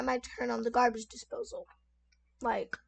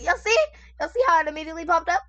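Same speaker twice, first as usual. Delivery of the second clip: excited; 5 semitones higher, 6 dB louder, faster than usual. Real, not acted. Excited by the search result.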